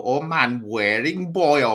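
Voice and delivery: lightly imitated Welsh press officer, drawn out; slow, stretched comic Welsh accent. Fan quoting Dad's Army's press officer, Welsh accent. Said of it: "And he'd talk like that".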